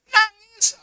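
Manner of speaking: loud, high wail on "Nangis"; mock sobbing. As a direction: wail the word like a hammed-up sob. Preacher mimics man weeping.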